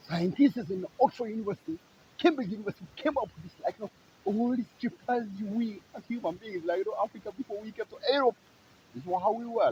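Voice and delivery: high-pitched, mocking hype; mock announcement. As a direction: announce it like a grand breakthrough. Mocking university announcements of human origins.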